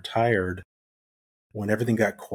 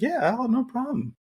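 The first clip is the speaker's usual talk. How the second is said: squeaky high put-on voice; high comic falsetto agreement; mock breezy reply; 14 semitones higher than usual. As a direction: play it falsely casual in a high voice. Mock casual reply to boss.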